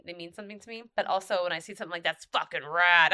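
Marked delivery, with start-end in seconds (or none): 2.0-3.1 slow, loud and excited. Retold or imitated. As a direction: savor it, impressed. Quoting own excitement.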